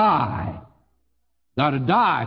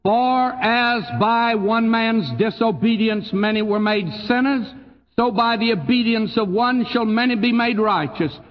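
raised, measured Bible reading from the pulpit; scripture reading; 6 semitones higher than usual. Acted. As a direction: read the verse aloud to the congregation. Reads second verse aloud.

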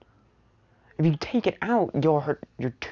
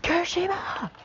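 panicked cry; 6 semitones higher than usual. Acted. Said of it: "he was like".

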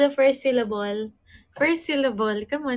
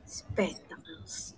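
slow, soft whisper; 5 semitones lower, 12 dB quieter, much slower than usual. Real, not acted. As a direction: whisper the word slowly to yourself. Repeating the puzzle word under breath.

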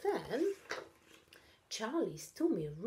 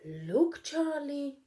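louder call to Charlie; 7 semitones higher, 6 dB louder, slower than usual. A narrator's reading. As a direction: call him over. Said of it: "called Katy".

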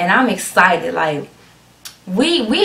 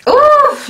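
high, loud "oof" of anticipation; sharp, excited high squeal; excited whoop; 5 dB louder than usual. Real, not acted. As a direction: let out a high hyped whoop. Hyped before playing the video.

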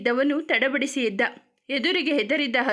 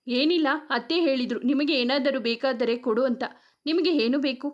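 ordinary delivery

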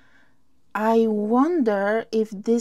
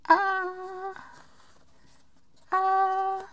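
high squeal of delight; 9 semitones higher, 4 dB quieter than usual. Real, not acted.

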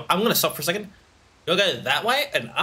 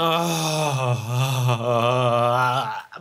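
crying laugh; 4 semitones lower than usual. Real, not acted. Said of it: "I'm laughing 'cause it's so damn funny".

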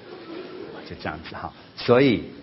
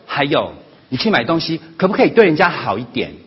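raised, higher voice, piling up grievances; nagging complaints; 6 semitones higher, 7 dB louder than usual. Retold or imitated. Son airs his grievances to his mother, loud and nagging. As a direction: scold her loudly, one fault after another.

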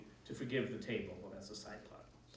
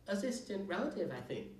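mewing kitten; 7 semitones higher than usual. A narrator's reading. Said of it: "mewed the kitten".

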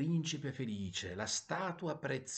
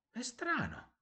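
high-pitched note of wonder; lilting, puzzled, up high; puzzled surprise; slower than usual. A narrator's reading. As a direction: voice a little bird's mild astonishment. Swallow surprised by its warmth.

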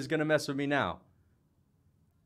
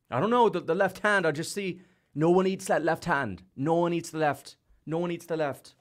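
higher-pitched parody of hyped fans; mock McGregor fans; 5 semitones higher than usual. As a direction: mimic fans insisting, repeating in a raised voice. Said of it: "leave it to the McGregor fans to say".

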